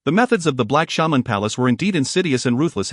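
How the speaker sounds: plain reading voice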